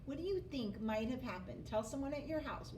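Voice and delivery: usual speaking voice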